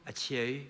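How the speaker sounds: a muffled Northern Irish sneeze; Ulster sneeze. Shown as a demonstration. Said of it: "people from Northern Ireland go".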